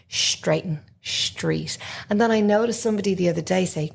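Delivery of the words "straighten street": straighten and street with a sh, shtreet; shtr sound. Shown as a demonstration. Teacher mimics the kids' Southern street. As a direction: soften the s to sh in street. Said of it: "listen to the kids".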